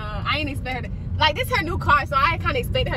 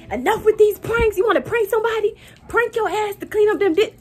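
louder, higher mock scolding from mom; 7 semitones higher, 4 dB louder than usual. Son retells mom scolding about pranks, mock angry. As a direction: play the fussing mother, hammed-up and loud. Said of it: "My mama talking about something".

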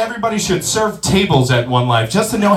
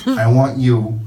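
much lower, softer mob-boss murmur; quiet menacing orders from a wiseguy; Tony Soprano; 9 semitones lower, 4 dB quieter than usual. Retold or imitated. Buffet guest pulling waiter aside, low mobster voice. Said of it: "now I got Tony Soprano on my ass".

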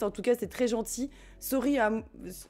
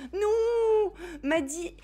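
calling out; 8 semitones higher, much slower than usual. Real, not acted. Calls her lost cat Maddy.

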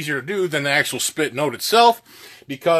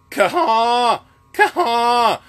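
high, sharp "kaha" bursts; imitating the spit attack; spit part; 9 semitones higher, 4 dB louder, much slower than usual. Shown as a demonstration. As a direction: pop the air like a call's spit. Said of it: "So the spit part before it".

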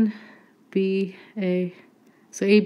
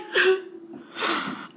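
high breathless giggle; squealing laugh; 13 semitones higher than usual. Real, not acted. Vlogger giggling over the tiny toy, high squeaky laugh.

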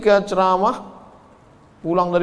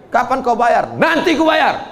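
a hint of a higher, louder bark; mock snap; 6 semitones higher, 3 dB louder than usual. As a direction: push back half-acted. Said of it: "Dia bentak, bentak lagi".

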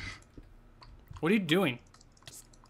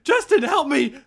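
much higher, louder, half-laughing begging; panicked pleading; 8 semitones higher, 5 dB louder than usual. Real, not acted. Stranded player begging Justin to teleport him, high and panicky. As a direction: beg for rescue in mock panic.

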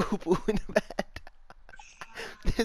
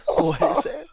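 laughing; 9 semitones higher, 8 dB louder than usual. Real, not acted. Cracking up at poop news.

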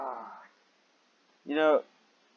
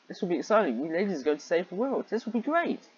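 higher and higher, lightly voiced bubbly girl; excited Daisy; 6 semitones higher, faster than usual. Reader gives Daisy a hint of rising excitement. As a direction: rise with each line like a giddy girl. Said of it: "Daisy smiled as she was excited".

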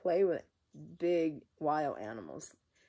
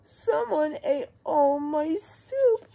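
high, slow little cub voice; Baby Bear; 10 semitones higher, 7 dB louder, slower than usual. Acted. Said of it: "and she says".

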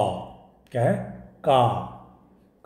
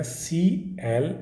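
slow spelling; much slower than usual. Shown as a demonstration. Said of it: "इसका spelling देख लें".

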